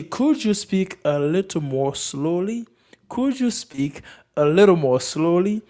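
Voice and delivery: slow, measured words with rising end; slow model question. Shown as a demonstration. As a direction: say it slowly for learners. Teacher models phrase for learners.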